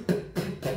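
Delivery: whispered p, p, p with a puff of air; popped p sounds. Shown as a demonstration. Voice coach demonstrating the popping p, breathy puffs. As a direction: blow out a burst of air on each p. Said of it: "donc le fameux p".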